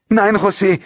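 high-pitched desperate cry; calling out. A narrator's reading. Mother pleading with an angry father, high desperate call. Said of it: "hörte Maria ihre Mutter rufen".

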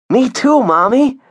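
put-on very high, loud child chiming in; eager little kid. Augie joining his sister's promise to mom, eager little kid. Younger child joins the plea.

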